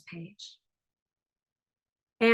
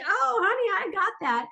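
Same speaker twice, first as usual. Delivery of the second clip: high, sassy mock confidence; breezy overconfidence; 8 semitones higher than usual. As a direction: toss it off high, playing sure of yourself. Acts out overconfident reply.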